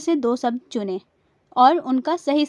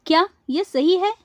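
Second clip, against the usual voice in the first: ordinary talk, nothing marked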